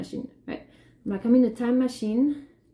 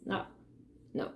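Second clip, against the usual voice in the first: ordinary talk, nothing marked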